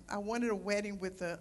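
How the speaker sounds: normal talking voice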